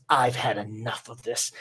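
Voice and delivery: a hint of a menacing demon lord; fed-up demon. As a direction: say it as the last straw. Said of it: "And he goes".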